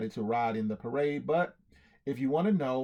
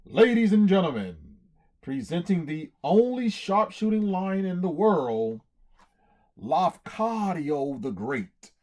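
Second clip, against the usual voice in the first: ringmaster hollering; 3 semitones higher, 7 dB louder than usual. Acted. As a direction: announce the star like a circus ringmaster. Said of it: "the ringmaster with the long mustache hollered".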